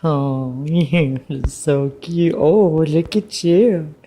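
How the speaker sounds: slow tender coo. Real, not acted. Grandma cooing at newborn baby.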